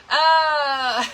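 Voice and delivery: loud, laughing uh; real laugh. Real, not acted. Laughing at a viewer comment, loud. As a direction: break into laughter.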